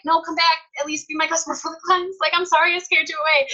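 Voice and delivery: high, half-playful imitated plea; mock pleading. Seller re-enacting pleas to lost customers, mock pleading.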